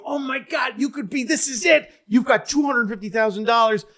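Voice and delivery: high, breathless game show hype; excited host. Retold or imitated. Host hyping the contestant about the million, high and excited. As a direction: dangle the millions like a hyped-up host.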